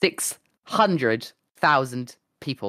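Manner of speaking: slow, spaced-out words for effect; slow dramatic reveal. Real, not acted. Streamer reveals queue size to friend, slow and dramatic. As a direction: let each word land separately.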